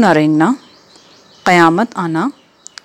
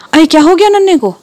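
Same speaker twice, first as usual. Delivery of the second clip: higher, louder startled call; a mother's startled call from the next room; alarmed cry; 9 semitones higher, 5 dB louder than usual. Acted. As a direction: cry out in alarm at the child's scream. Wife hears child fall.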